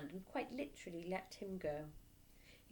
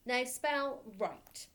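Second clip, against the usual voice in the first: slow, drawn-out order; slow command; 5 semitones higher, 5 dB louder, much slower than usual. Acted. Headmistress ordering the boy to spell, slow and menacing. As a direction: stretch every word to intimidate.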